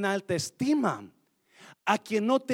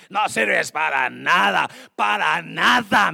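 scornful wife; 8 dB louder, slower than usual. Retold or imitated. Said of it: "cuántas mujeres le".

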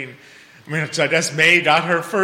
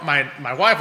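ordinary delivery

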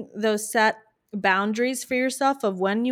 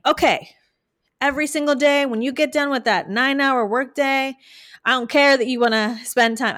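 louder, higher mock drill of a client; demanding coach; 5 semitones higher, 6 dB louder than usual. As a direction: lay down the law, hammed up. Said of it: "rather than me saying".

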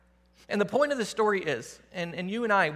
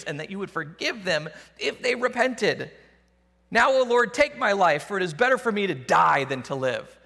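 Jonah's louder, higher rant at God; angry Jonah; 3 semitones higher, 4 dB louder than usual. Acted. Jonah protesting God's mercy, angry and pleading. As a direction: rail at God, then beg to die.

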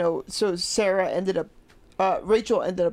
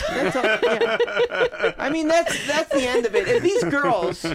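laughing; 6 semitones higher, 4 dB louder than usual. Real, not acted. Laughing at own point.

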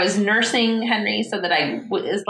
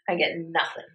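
ordinary talk, nothing marked

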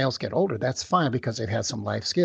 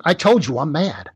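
put-on louder, snapping teenage boy, fed up; irritated teen; 4 semitones higher, 6 dB louder than usual. Teen snapping at a parent who keeps asking, louder and irritated. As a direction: bite back at the repeated question.

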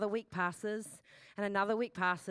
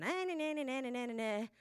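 softer, whiny grumbling; mock whinge; 5 dB quieter than usual. Preacher imitating her own whinge to God, softly mocking. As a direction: grumble it like a sulky kid. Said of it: "a bit of a whinge to God".

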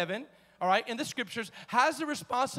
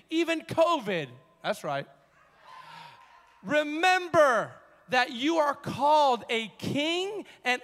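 raised, emphatic preacher's delivery; impassioned preaching; 3 semitones higher than usual. Real, not acted. Preacher urging the church to grasp its privilege, impassioned. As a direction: punch the key words and stretch them out.

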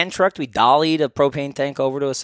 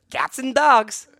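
mock announcing; 8 semitones higher, much slower than usual. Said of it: "It was drizzling".

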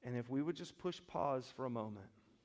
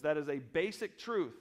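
normal talking voice

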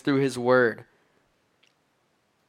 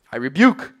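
commanding rebuke; 7 dB louder than usual. Acted. Preacher voices Jesus ordering the wind and waves, commanding rebuke. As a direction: burst out with authority.

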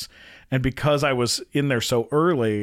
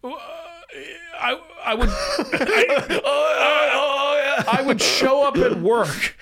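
mock crying; 13 semitones higher than usual. Actor recalling work among stars, mock sobbing. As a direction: blubber through it in a high voice. Breaks up recalling being least famous.

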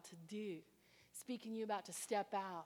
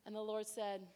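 ordinary delivery

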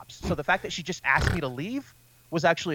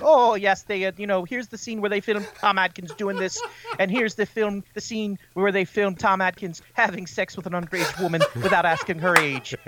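put-on higher lilting old Irish woman; Irish accent; 5 semitones higher than usual. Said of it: "this lovely old Irish woman".

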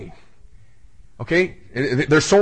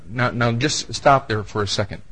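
ordinary delivery

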